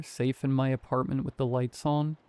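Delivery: ordinary delivery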